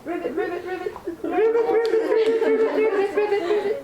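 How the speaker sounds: high rivet croaking; frog croak. Shown as a demonstration. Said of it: "So are they croaking the second group?".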